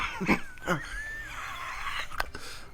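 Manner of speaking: raspy wheeze of a laugh; smoker's wheezing laugh. Real, not acted. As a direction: cackle like a heavy smoker. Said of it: "I sound like a smoker".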